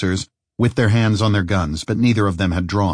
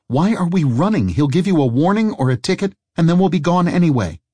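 alarmed protest; 9 semitones higher than usual. Acted.

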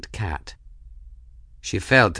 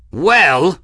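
loud, higher laughing roar; 8 semitones higher, 10 dB louder than usual. A narrator's reading. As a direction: bellow it back with glee. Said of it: "the pilot roared, laughing".

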